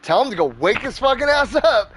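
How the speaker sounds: retold urgent order. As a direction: re-enact ordering a son to rouse someone.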